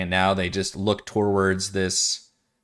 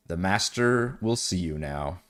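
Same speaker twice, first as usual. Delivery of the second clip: slow, soft, ominous puppet voice; eerie whisper-like voice; 3 semitones lower, 4 dB quieter, slower than usual. Acted. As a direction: speak like a hollow possessed minion. Narrator voices the pirates.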